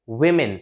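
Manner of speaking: women with "wi" first vowel, falling; plural women pronunciation. Shown as a demonstration. Teacher correcting learners, plural pronunciation. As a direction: keep women unrounded, "wi-men". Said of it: "वो नहीं पढ़ना".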